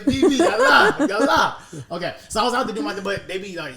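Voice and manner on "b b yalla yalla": high, loud mock pushy street vendor. Tourist mimics aggressive Cairo street vendors, loud and high. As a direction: call out like a pushy Egyptian seller. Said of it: "they're, like, aggressive".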